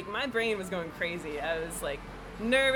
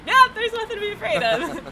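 much higher and louder, bright reassurance; beaming reassurance; 12 semitones higher, 10 dB louder than usual. Retold or imitated. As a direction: announce it with cheerful confidence, relieved. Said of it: "and then seeing, like".

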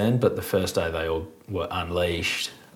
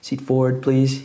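flight attendant; 6 semitones higher, 3 dB louder than usual. Retold or imitated. Acted flight attendant request.

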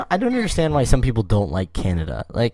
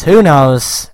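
louder, higher who knows; emphatic; 6 semitones higher, 10 dB louder than usual. Real, not acted. Host shrugging off her own point, emphatic.

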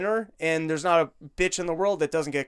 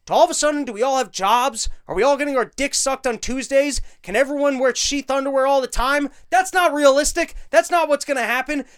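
louder, higher ranting questions; indignant rising rant at politics; heated rant; 6 semitones higher, 4 dB louder than usual. Real, not acted. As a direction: rail at the idea, voice up and loud.

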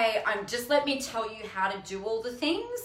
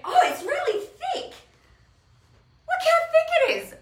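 delighted squeal; 6 dB louder than usual. Real, not acted. Admiring her new book.

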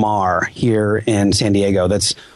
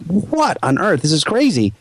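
amazed disbelief; 6 semitones higher than usual. Retold or imitated. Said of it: "I'm like".

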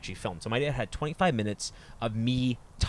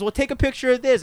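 loud, high-pitched mock dad taunt; high, loud, joking dad voice; imitating joking dad; 14 semitones higher, 7 dB louder than usual. Dad taunts son before mooning him, imitating joking dad.

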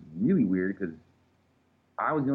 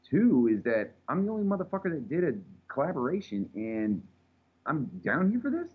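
higher pitched indignant outrage; retold pissed off; 6 semitones higher than usual. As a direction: recount being stuck at the bottom with rising outrage. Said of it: "I'm, like, pissed off now".

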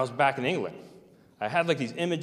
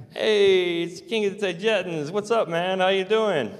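much higher and louder, a rowdy barroom hello; drunk buddy greeting; 9 semitones higher, 5 dB louder, slower than usual. Retold or imitated. Drunk soldier greeting the narrator at the base bar, loud and high. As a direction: hail him like a drunk mate at the bar. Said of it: "this guy goes".

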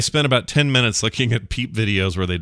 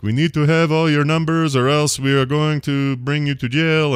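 a hint of a Russian scammer accent, pushed a bit higher and louder; mock scammer accent; 6 semitones higher, 4 dB louder than usual. Retelling the scam call with a mock Russian accent. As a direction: threaten like a fake IRS caller with a put-on Russian accent. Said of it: "they're doing it in an accent".

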